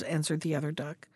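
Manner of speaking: ordinary delivery, nothing marked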